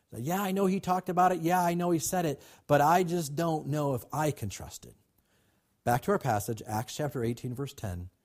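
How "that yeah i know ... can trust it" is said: doubting believer. Acted. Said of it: "That's our human nature".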